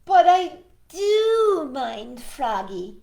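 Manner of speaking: a rabbit standing his ground at last; firm Rabbit. Acted. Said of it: "he said at last".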